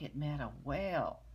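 ordinary delivery

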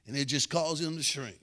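ordinary delivery

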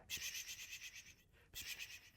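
soft voiceless "piş, piş" to coax a cat; whispered cat call. Real, not acted. Gamer coaxing an in-game cat closer, whispering. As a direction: coax the kitty over, barely breathing it out.

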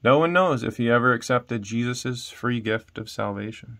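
ordinary delivery, nothing marked

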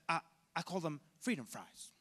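halting presidential impression with a stammered start; George Bush. Lecturer mimicking the president renaming French fries, George Bush impression. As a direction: do a mocking Bush voice. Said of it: "that's George Bush, I'm sorry".

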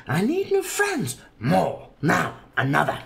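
very high, slow whiny prince; squeaky prince crying out for friends; cried out prince. Acted. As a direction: whine it like a spoiled prince. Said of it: "The prince cried out".

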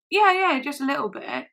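high, loud yeah-yeah, easing off; deflecting. Retold or imitated. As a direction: imitate someone brushing off the question. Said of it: "really deflecting".

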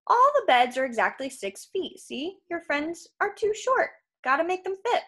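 salesman patter climbing high; giant salesman, explaining. Acted. Krusty explaining his beds to the boy, cheerful salesman. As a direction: explain it like an obvious sales point.

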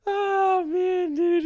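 high, teary sigh after laughing; weak, high-pitched leftover giggles; real worn-out laughter. Real, not acted. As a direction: wheeze it out as the laughter subsides. Recovering from a laughing fit.

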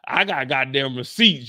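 ordinary delivery

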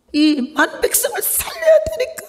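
a sudden tearful, high cry. Real, not acted. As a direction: voice cracking high with a sob. Preacher overcome describing Jesus' sacrifice.

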